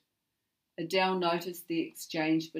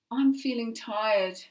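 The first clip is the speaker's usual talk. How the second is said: announcing; 7 semitones higher, 9 dB louder, slower than usual. A narrator's reading. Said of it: "she announced".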